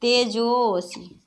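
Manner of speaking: तेजोसी said slowly, the जो stretched out; long-vowel demonstration. Shown as a demonstration. Teacher answering "what are we writing", तेजोसी slowly with a prolonged जो. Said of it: "जैसे दीर्घ उच्चारण".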